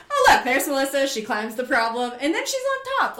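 high, loud, cheery mock commentary; bright-pitched pretend highlight reel; breezy presenting. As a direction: show off an effortless climb, laugh in voice. Voices the edited video's cheery version.

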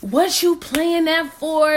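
a retold angry snap; heated demand. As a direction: needle her back in her irritated tone. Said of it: "She was hot".

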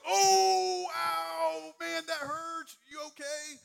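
high, loud alarmed fuss; mock panicked overreaction. Counselor overreacting to a camper's fall, loud fake alarm. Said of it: "we're like".

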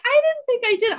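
high-pitched retold protest; defensive protest. Answering a girl who criticized her talking, high defensive protest. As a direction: protest your innocence up high.

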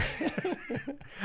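high burst of laughter. Real, not acted. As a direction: laugh out at the joke.

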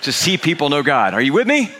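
rally the room, peaking on the final question; rousing preaching. Real, not acted. Preacher asking the congregation if they are with him, rousing.

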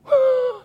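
sharp, high gasp of amazement; amazed gasp. Retold or imitated. Man recalling the Lord's answer after a phone call, amazed gasp. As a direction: gasp as if overcome. Acting out amazed reaction to God.

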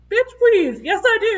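high, loud mock-outraged comeback; indignant retort. Fan retelling comeback to man who corrected her, indignant. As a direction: throw it back loud and high, incredulous.

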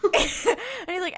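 words through laughter; laughing. Real, not acted. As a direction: laugh while speaking. Laughing while retelling.

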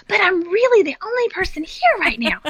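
very high, incredulous mock pleading; high-voiced exasperated retort; retold protest. Retells her protest to employee.

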